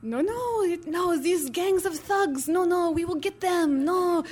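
put-on a gentle French protest, lightly accented. French photographer Jerome reassuring her, light French accent. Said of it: "this lovely French photographer, Jerome, is like".

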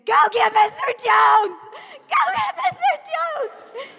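high, very loud frantic shout; screaming for help. Retold or imitated. Teacher holding back a fighting girl, screaming for help. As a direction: scream it across the school. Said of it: "and I was screaming".

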